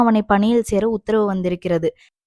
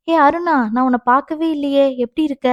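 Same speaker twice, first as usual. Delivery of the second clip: higher, lively hello; pleasantly surprised greeting; 5 semitones higher, 3 dB louder than usual. Acted. Visitor spots a friend at the hospital, pleasantly surprised. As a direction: greet a friend you had not noticed.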